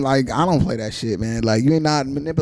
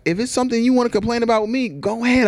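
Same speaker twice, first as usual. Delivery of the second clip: open, inviting; 10 semitones higher than usual. Retold or imitated. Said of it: "I be like".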